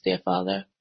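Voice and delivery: ordinary talk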